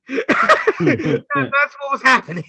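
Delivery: slow words through real laughter; chuckling it out; laughing while talking. Real, not acted. Laughing while explaining giggles.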